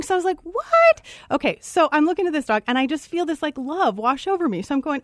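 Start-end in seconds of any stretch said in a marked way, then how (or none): 0.4-1.0 a far higher, louder shocked "What?"; astonished. Retold or imitated. Hiker reacting to the dog winking again, shocked squeal. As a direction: squeal it in total disbelief. Dog winks a second time.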